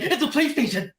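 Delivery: high-pitched, giddy mock blurt; overexcited kid. Excited gift-giver spoiling the present, overexcited kid voice. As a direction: blurt it like a kid bursting to tell. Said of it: "he's like an overexcited kid".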